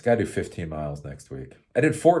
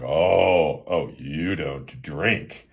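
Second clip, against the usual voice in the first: mock disapproval; 4 semitones lower, much slower than usual. People reacting to not drinking.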